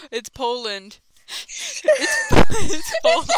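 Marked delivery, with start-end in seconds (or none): 1.3-3.3 laughing. Real, not acted. Laughing through a line.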